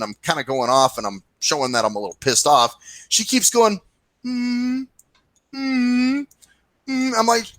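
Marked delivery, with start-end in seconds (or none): 4.2-7.1 high, drawn-out mock hum; kazoo-like humming. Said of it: "some, a goddamn kazoo".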